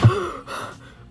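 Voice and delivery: imitated shocked gasp; big acted gasp of someone freaking out; sharp gasp. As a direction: suck in a mock-startled breath. Imitates friend gasping at sight of star.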